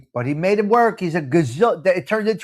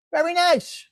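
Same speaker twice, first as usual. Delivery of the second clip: high mock praise; 10 semitones higher than usual. Second take of the praise, higher.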